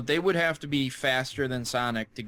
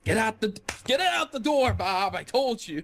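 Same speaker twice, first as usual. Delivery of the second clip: high-pitched frantic boss impression; hammed-up urgent boss, pitched way up; mock frantic boss; 8 semitones higher than usual. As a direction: order staff out in a high mock panic. Acting out hurried Sega boss.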